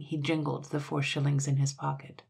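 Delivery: usual speaking voice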